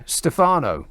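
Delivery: normal talking voice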